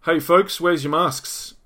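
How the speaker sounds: imitated officer calling out. Police officer challenging maskless shoppers, imitated calling out.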